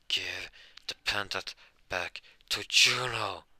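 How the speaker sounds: mounting demand, swelling on the name; forceful demand. Acted. Asta crushes the drunk's hand for Juno, forceful demand.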